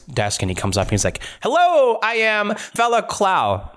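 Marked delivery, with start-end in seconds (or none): 1.4-3.7 high, drawn-out character hello; grand self-introduction. Acted. Blacksmith atop his ladder greets the party, high slow introduction. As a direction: announce yourself slowly, pitched well up.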